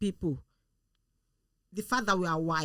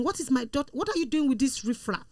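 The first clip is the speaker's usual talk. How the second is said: imitated shouting; 6 semitones higher than usual. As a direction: act out a mother indignantly berating her daughter. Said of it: "then she started shouting".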